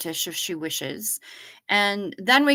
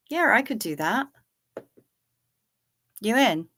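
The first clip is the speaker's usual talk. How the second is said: ordinary talk, nothing marked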